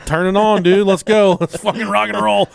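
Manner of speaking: loud, high-pitched challenge; fired up. Retold or imitated. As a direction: jump at the bet, all pumped up. Eagerly accepting brother's Madden bet.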